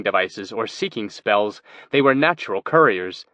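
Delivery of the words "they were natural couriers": ordinary delivery, nothing marked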